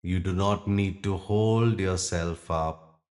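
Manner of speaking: usual speaking voice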